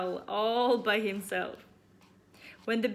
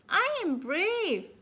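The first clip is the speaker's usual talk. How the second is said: brave little bear; 10 semitones higher, 6 dB louder than usual. Acted. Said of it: "said Baby Bear suddenly".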